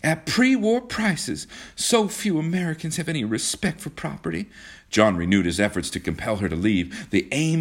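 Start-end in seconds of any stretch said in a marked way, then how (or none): 0.0-4.5 high-pitched indignant exclamation; raised, complaining cry. A narrator's reading. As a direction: exclaim it high over the noise. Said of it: "cried Kismine".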